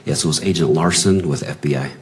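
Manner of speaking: ordinary talk, nothing marked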